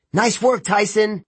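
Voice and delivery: high, excited call; high-pitched congratulatory holler; yelled praise. A narrator's reading. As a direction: cheer on a teammate in a high yell. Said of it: "I yelled".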